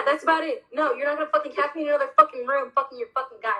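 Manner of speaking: ordinary delivery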